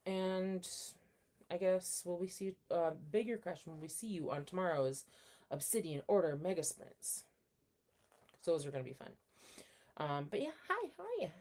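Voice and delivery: ordinary delivery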